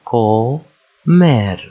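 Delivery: Comer split into CO, pause, MER; syllable by syllable. Shown as a demonstration. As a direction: sound out Comer with the consonants pulled apart. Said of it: "we separate the consonants".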